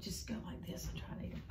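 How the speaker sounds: soft fast whisper. Real, not acted. Teacher whispering to the boy how to eat turnips. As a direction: hush the tip like a secret.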